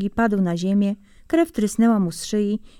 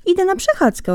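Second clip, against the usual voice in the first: bright, much higher innocent reply; hedgehog answering; 11 semitones higher than usual. Acted. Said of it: "odparł Jeż". (Jeż odpowiada zającowi niewinnie, wysoko)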